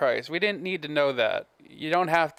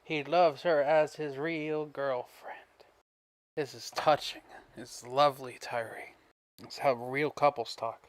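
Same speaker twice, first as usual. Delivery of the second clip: mock touched; 3 dB quieter than usual. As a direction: coo softly as if deeply moved. Co-host mock-coos at his declaration.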